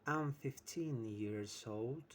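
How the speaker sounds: drawn out and loud, like reading a task to pupils; slow exercise read. Shown as a demonstration. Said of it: "And what about".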